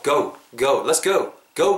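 "go" said as a diphthong, "고우"; gliding vowel. Shown as a demonstration. Teacher correcting a plain "고", gliding "go". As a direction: round "go" from oh into oo. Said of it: "어우 정도로 발음한다".

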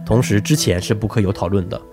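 normal talking voice

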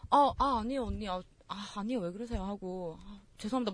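soft, apologetic backpedalling; hesitant, humble role-play of a junior; deferential junior. Acted. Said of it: "진짜 제대로 된 후배라면".

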